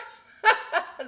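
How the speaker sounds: bright burst of laughter. Real, not acted. Librarian cracking up at the chicken voice. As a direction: laugh out loud. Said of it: "That one makes me laugh".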